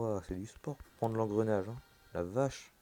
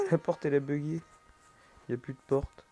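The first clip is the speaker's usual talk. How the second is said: laughing; 5 semitones higher, 4 dB louder than usual. Real, not acted. Laughing at bugged door.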